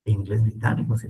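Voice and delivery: ordinary talk, nothing marked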